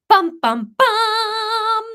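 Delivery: sung-out dramatic sting, rising high on the last; hammed-up suspense chord, voiced; mock dramatic drumroll; dramatic dun dun dun. Dramatic reveal sound effect.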